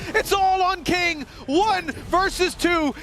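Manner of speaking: high, urgent play-by-play call; esports caster. Real, not acted. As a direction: call the clutch with rising tension. Caster calling a tense late round.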